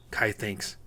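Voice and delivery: usual speaking voice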